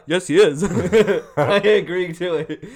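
loud, laughing through words. Real, not acted. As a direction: giggle through the line. Laughing about the fixed fight.

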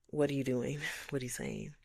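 ordinary talk

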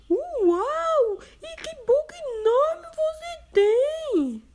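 put-on astonished girl. Girl shocked at the wolf's big mouth. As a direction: gasp at the huge mouth.

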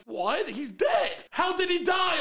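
loud, high-pitched disbelief; mock shock. Insurance caller learns that Sam is dead, mock shock. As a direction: shout it like a stunned caller.

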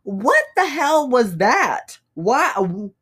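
slow, high, confused questioning; baffled. Retold or imitated. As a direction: play back your stunned confusion. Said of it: "and I was like".

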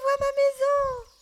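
put-on high, loud joyful shout of a boy; high loud boy's cry of joy; excited cry. A narrator's reading. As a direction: burst out with relief at seeing home. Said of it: "s'écrie tout à coup Martin".

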